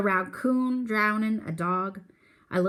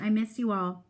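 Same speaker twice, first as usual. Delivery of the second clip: ordinary delivery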